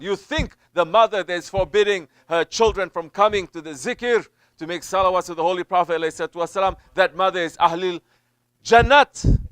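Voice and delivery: loud, high, building sermon climax; fiery preaching. Acted. Preacher warning mothers who forbid zikr, loud and building. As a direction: hammer the warning home, building to hell.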